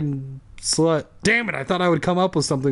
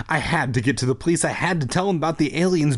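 ordinary delivery

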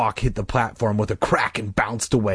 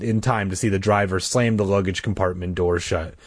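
plain reading voice

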